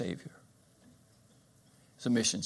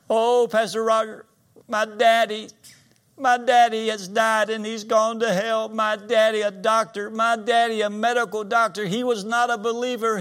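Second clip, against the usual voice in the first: high, loud mock wail; imitated sobbing; 11 semitones higher, 6 dB louder than usual. Preacher acts lady grieving her daddy to pastor, wailing in imitation. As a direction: act a distraught daughter wailing without let-up. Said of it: "she's sobbing".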